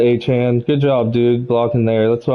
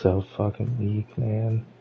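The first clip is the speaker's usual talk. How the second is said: deflated mutter; 9 dB quieter than usual. Real, not acted. Deflated after loss.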